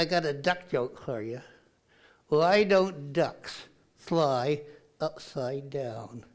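usual speaking voice

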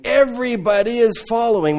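high, loud alarmed cry; panicked Pharisees. Retold or imitated. Preacher voicing Pharisees panicking at the crowds, high alarmed cry. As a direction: sound the alarm as the frightened Pharisees.

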